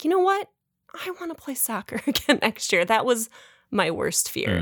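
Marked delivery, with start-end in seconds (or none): 0.0-2.8 put-on high, eager kid voice; lightly voiced excited kid; daughter voice. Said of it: "she'd be like".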